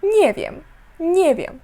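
"Nie wiem" run together, first syllable stressed; read as one word. Shown as a demonstration. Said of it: "читається начебто одне велике".

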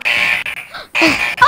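startled loud gasp; sharp gasp. Acted. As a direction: gasp in sudden alarm. Alarm goes off suddenly.

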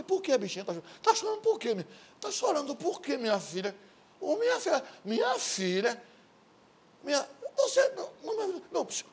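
put-on very high, cooing comfort to a crying girl; hammed-up soothing father; mock tender dad. Voicing dad comforting crying daughter.

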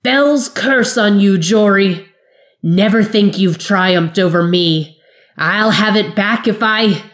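loud, high, slow furious voice; choking fury. Acted. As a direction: spit out the curse, strangled with rage. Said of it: "the wizard's voice called in a choke of fury".

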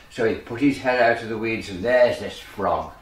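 plain reading voice

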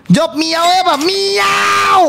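high, drawn-out "miau"; excited meow call. Real, not acted. Streamer urging viewers to pick the meow answer, excited high call. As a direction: belt out a long, high meow.

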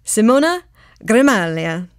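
a light attempt at Italian pronunciation; Italian accent. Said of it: "That is my best Italian accent".

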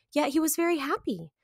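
usual speaking voice

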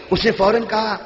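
normal talking voice

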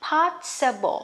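possible said clearly, ending in a light uh-bl; teacher saying possible; modeled pronunciation. Shown as a demonstration. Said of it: "Rồi".

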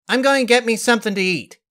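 high, quick youthful voice; high fast girl voice; young daughter. Acted. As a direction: announce it and hurry off.

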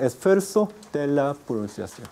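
ordinary talk, nothing marked

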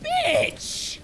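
shrieks at a jump scare; sudden high scared yell; startled scream. Real, not acted. Jump scare in horror game.

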